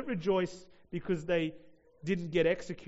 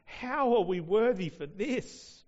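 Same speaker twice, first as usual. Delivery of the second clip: slow, louder and higher joyful cry of wonder; 5 semitones higher, 7 dB louder than usual. Retold or imitated. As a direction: cry it out in amazed joy. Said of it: "that cries out".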